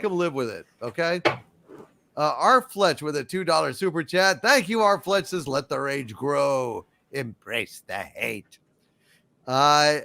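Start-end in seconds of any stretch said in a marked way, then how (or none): 5.5-8.6 sinister voice. Acted. Reads sinister chat line dramatically.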